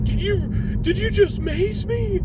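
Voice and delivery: very high, stammered disbelief; imitated shocked outcry. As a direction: mimic a man who just got maced. Maced man's shocked reaction.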